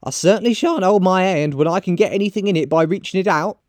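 high, insistent character voice; high-pitched, unashamed woman; brazen charwoman. Acted. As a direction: urge him on in a high voice. Charwoman defends taking things.

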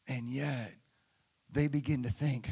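ordinary delivery

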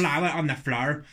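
mock Northern Irish catchphrase; Northern Irish accent. Shown as a demonstration. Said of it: "you got the Northern Irish".

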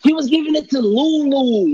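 high, loud scoffing repeat; mocking echo. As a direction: parrot it back, loud and incredulous.

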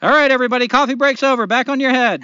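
high, loud foreman's call; devil's overseer calling the break over, shouting; shouted order. Acted. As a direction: bark it across the room to everyone.

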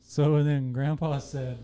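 soft, slow storytelling; quiet, drawn-out mock anecdote; mock fade-out chat. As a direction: murmur it slowly as if fading out. Mock fade-out storytelling under outro.

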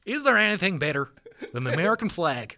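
proud country-boy accent put on for laughs. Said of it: "like, a country accent for it".

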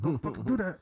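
a fed-up parent snapping the second time; angry parent. Retold or imitated. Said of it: "political mom dad type thing".

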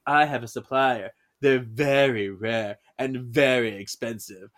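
put-on slow, low, haughty French accent; bad French accent. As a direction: channel a snooty assistant with bad French accent. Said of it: "the girl doing a bad French accent".

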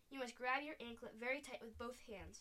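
plain reading voice

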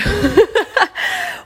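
bright high laugh; high giggle. Real, not acted. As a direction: giggle at yourself. Laughs at her own intro.

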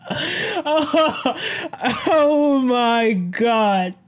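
high, drawn-out laughing exclamation. Real, not acted. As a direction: groan it out slowly through real laughter. Recovering from the laughing fit.

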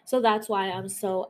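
ordinary talk, nothing marked

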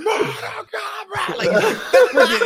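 very high, drawn-out strained scream; held-back falsetto mock roar; mock yelling. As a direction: imitate a wild brother's battle cry, slow and stretched.

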